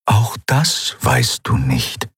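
slow, measured spirit voice; calm Eidolon. Acted. Eidolon to Kai, calmly countering his doubt, slow and measured. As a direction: answer slowly, unshaken.

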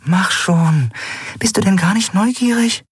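high, thin inward plea; silent pleading. A narrator's reading. Nelen willing the guard to leave, pleading. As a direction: urge someone on without being heard. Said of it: "flehte Nelen stumm".

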